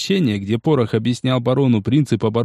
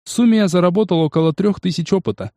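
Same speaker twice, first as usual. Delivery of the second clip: plain reading voice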